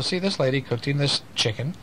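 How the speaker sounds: ordinary delivery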